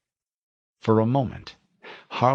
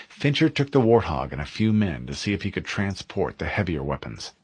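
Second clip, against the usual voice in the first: usual speaking voice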